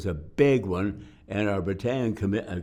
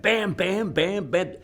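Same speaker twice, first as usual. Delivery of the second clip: high, punchy bam bam bam; mimicking a forty-five going off; imitated gunshots; 11 semitones higher, 4 dB louder, slower than usual. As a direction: spit out the shots like a pistol firing. Imitating his pistol shots.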